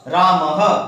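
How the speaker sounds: "रामः" with a light breathy ह at the end; visarga demo. Shown as a demonstration. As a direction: end "रामः" on a half-length ह. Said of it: "ह के समान उच्चारण करेंगे". ("रामः" में आधा ह)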